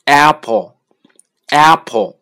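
slow Apple with the mouth opened wide; exaggerated Apple. Shown as a demonstration. Teacher exaggerating Apple for learners. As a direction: open the mouth big on Apple. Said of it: "发的夸张一点".

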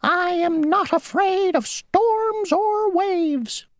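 very high, squeaky and self-important; a tiny insect bragging; the mosquito bragging to the grasshopper; boastful mosquito. Acted. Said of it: "said the mosquito".

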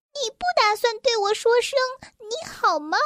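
high, quick little elf asking; tiny elf voice. A narrator's reading. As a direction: ask in a small, high-pitched elf voice. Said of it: "细小的声音".

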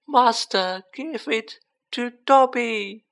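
high, slow, loud elf voice; wondering elf. A narrator's reading. Dobby given a sock by his master, in wonderment. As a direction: stretch each word out in high-pitched amazement. Elf's amazed speech continues.

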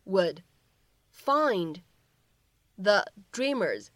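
slow, deliberate reading of the English line; slow model reading. Shown as a demonstration. Teacher reading a line to students, slow and deliberate. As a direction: read each phrase slowly for learners.

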